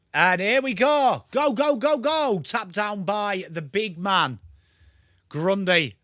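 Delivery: rising to a high go go go, then slow call; mock footy commentary. Streamer calls the centre bounce for chat, mock commentary. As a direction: call the opening bounce like a TV caller.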